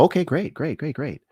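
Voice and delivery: high, soft, repeated assent; acted-out grateful going-along; eager agreement. Acted eager reply to judge.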